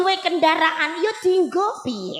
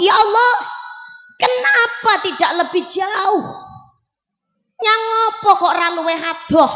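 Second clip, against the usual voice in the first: dying man's cry; 2 semitones higher than usual. Acted. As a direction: cry out to God in the last breath. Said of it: "Bojo kula niku bengok".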